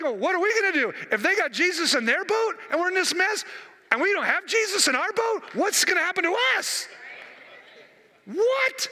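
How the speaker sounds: high-pitched, worried questioning, climbing to a squeak; mock alarm. People in the other boats without Jesus, mock alarm rising.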